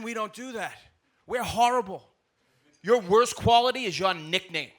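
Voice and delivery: normal talking voice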